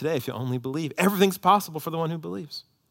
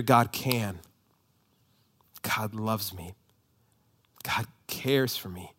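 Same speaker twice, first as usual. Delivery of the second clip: softer, slow, settled affirmation; quiet faith; 4 semitones lower, 4 dB quieter, slower than usual. Shown as a demonstration. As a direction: say it softly as settled trust. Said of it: "and you hear".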